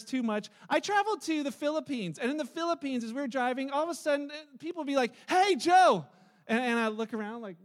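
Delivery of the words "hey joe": higher and louder friendly street call; calling out Hey Joe. Retold or imitated. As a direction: call across the road to a passing stranger.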